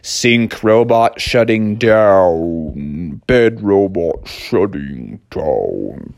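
low, very slow robot drone; deep, dragging machine voice; powering-down robot. Acted. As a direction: wind down like a dying machine.

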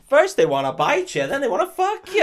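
loud, high-pitched mock routine; hammed-up stand-up comic. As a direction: punch it out to a comedy crowd. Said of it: "Transylvania open mic night".

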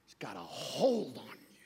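breathy dramatic whisper. Acted. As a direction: whisper it like a secret.

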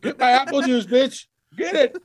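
very high-pitched mock demand; hammed-up high voice, bossing the nurse; demanding old resident. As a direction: squawk orders like a cranky care-home resident. Acts demanding nursing home resident.

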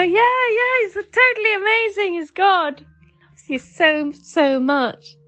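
high, overjoyed yeah yeah; gushing agreement. Retold or imitated. Mum agreeing with a caller about God, high and elated. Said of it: "I go".

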